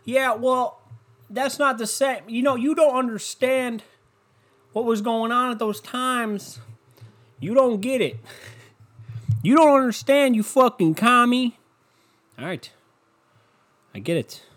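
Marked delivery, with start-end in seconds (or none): 0.0-11.6 high, put-on voice of a ranting gun-rights guy; mock angry gun nut. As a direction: play the outraged gun nut who won't shop there. Same gun nut answers back.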